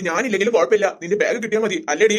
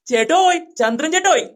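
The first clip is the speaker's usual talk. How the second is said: calling out; 6 semitones higher than usual. Acted. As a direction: hail chettan from a distance. Calling out to Chandran chettan. (നീട്ടി ഉറക്കെ വിളിക്കുന്നു)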